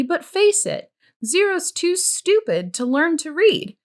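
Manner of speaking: high, patronizing dismissal; condescending scorn. Acted. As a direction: talk down to Stanley with contempt. Pendanski belittles Zero.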